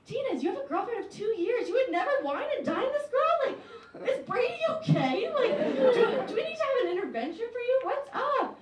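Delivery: high, laughing disbelief; incredulous confronting. Retold or imitated. Friends questioning Aaron, high incredulous laughing. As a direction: grill him with mock alarm. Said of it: "And then we're like".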